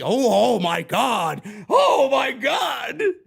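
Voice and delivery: slow, loud hammed-up gasp of awe; mock amazement. Mocking fans thrilled about swearing on TV, hammed-up slow shout. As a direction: drag out a sarcastic oh my God.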